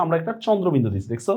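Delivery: normal talking voice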